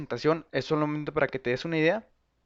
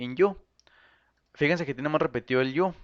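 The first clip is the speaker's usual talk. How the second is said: ordinary talk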